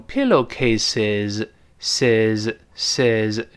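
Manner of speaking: "pillowcases，ses，ses" slowly, final s buzzed as z; full voiced ending. Shown as a demonstration. Teacher isolates the ending of "pillowcases，ses，ses", buzzing z. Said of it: "理论上应该读成".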